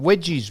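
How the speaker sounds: "wedgies" for veggies, with a W. Retold or imitated. He quotes her offering a side, "wedgies" with W for V. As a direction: say "wedgies" where veggies is meant. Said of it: "Oh, veggies".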